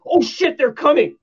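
loud, high-pitched mock panic; alarmed cry. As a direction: cry out as if danger is coming. Acting out alarmed viewer's reaction.

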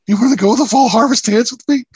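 put-on high, loud awkward teen asking out; squeaky teen asking someone out; shy nervous invite. Asking Eliza to dance, playful.

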